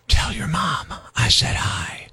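slow, low whispered menace; creepy whisper. Shown as a demonstration. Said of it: "creepy when whispered".

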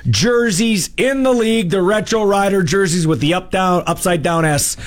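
upbeat high announcer pitch; radio promo read. Acted. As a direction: sell the contest with energy. Hyped contest promo read.

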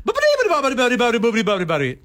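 rapid high-pitched cartoon gibberish; mock the game plumber's endless chirpy babble; Mario babble. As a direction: race through nonsense syllables, way up high. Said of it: "two hours of".

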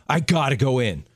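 ordinary talk, nothing marked

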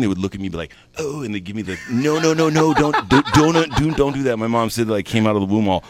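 ordinary delivery

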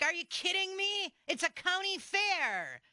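incredulous outburst. Retold or imitated. Host retelling her reaction to parking fees, high incredulous. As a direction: scoff at the idea of paying. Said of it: "And I'm like".

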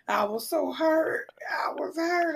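high, breaking cry of hurt; half-sobbing, voice cracking up. Retold or imitated. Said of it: "I was sitting there crying".

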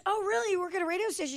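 high, eager oh really; imitating surprise. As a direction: mimic a girl perking up with interest. Quoting girl's eager surprise.

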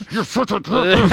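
embarrassed friend scolding through clenched teeth; ventriloquist hiss. Retold or imitated. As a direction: say it without moving your lips. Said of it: "trying to do the ventriloquist thing".

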